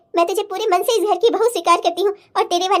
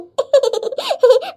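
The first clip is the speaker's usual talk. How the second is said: bright, higher joyful laugh; happy laugh; 4 semitones higher than usual. Acted. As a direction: laugh with relief. Mother laughs at happy news.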